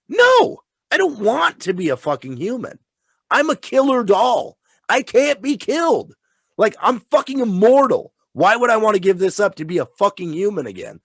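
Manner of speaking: high, emphatic killer-doll protest; indignant refusal, acted out with gusto; defiant Chucky. Killer doll refusing to become human, defiant and indignant. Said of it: "and he's like".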